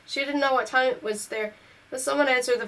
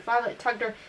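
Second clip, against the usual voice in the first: ordinary delivery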